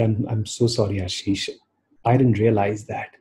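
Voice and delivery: barely there, sheepish sorry; weak voice. Retold or imitated. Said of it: "in a weak voice".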